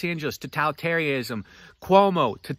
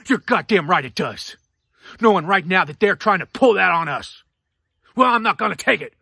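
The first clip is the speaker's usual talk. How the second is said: louder defiant outburst, higher on the last line; angry; 3 semitones higher, 6 dB louder than usual. Real, not acted. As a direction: spit it out in real defiance. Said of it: "it makes me angry".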